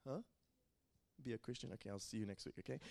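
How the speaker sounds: a quick joking nudge to the room; quiet aside. Real, not acted. Preacher teasing the youth group in a soft aside.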